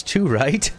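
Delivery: light mock Australian mateship talk; Aussie voice. Shown as a demonstration. Explaining the slang in a light Aussie accent. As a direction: play a matey Aussie. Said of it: "Bloody oath means".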